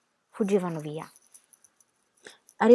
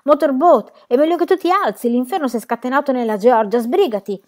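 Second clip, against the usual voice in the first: loud, raised, urgent call to wake a sleeper; 5 semitones higher, 8 dB louder than usual. A narrator's reading. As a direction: call out sharply to rouse your friend. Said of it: "Tea Cake chiamò senza muoversi". (richiamo forte e concitato)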